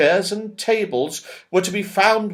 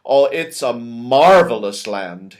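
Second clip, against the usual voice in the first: louder, enthusing Moonface voice; lightly voiced Moonface; 3 semitones lower, 5 dB louder than usual. Moonface praising the land to children, enthused. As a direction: sell the wonderful land. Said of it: "he said".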